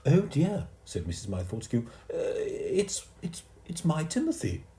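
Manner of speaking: high, stammering lady; embarrassed posh mother; flustered mother. A narrator's reading.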